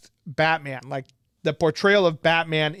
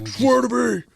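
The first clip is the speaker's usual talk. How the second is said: put-on vigilante shout; Batman; 8 semitones higher, 4 dB louder than usual. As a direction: echo the superhero's yell, high-pitched. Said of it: "I think we did Batman voice".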